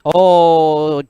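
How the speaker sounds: ordinary talk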